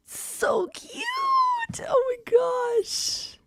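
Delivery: high squealing delight; gushing. Real, not acted. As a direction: squeal over something cute. Gushing over old photo.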